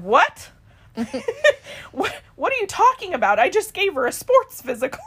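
high, loud incredulous retold doctor, laughing through it; shocked disbelief. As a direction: replay the doctor's stunned outburst. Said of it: "And so he's shocked".

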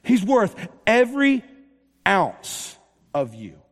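loud, driving sermon delivery; full-force preaching. Acted. Preacher rousing the congregation about Jesus, full-force preaching. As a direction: preach it out to the whole room.

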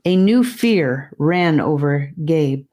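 usual speaking voice

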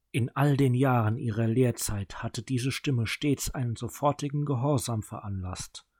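usual speaking voice

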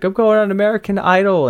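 high, proud announcement; confident girl quote. Retold or imitated. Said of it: "she was like".

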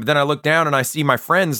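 ordinary delivery

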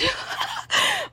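breathless laugh tipping into tears; real teary high laugh; tearful laugh. Real, not acted. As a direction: laugh that is half a sob. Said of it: "I'm literally gonna cry".

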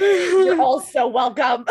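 slow, laughing; loud laughing thanks. Real, not acted. Laughing at her own story.

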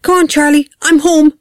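high singsong call through the house. A narrator's reading. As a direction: call your child from downstairs. Mother calling up to her son.

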